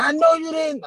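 high mock-outraged screech; angry mom. Mom confronting son next morning, outraged screech.

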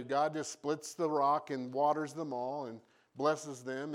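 usual speaking voice

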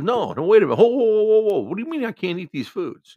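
high, loud mock outburst; gets mad. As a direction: imitate an indignant patient yelling. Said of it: "this guy gets mad".

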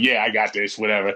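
low, offhand brush-off; dismissive. Retold or imitated. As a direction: toss it off low, as if already decided. Brushing off being told not to.

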